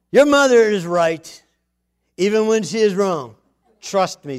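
dad advice. Retold or imitated. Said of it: "to say the darndest things".

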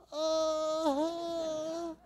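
high, soft, long groan; thin, pained imitation moan; beaten Loki whimper. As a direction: whimper weakly as if flattened on the floor. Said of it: "Loki on the floor there".

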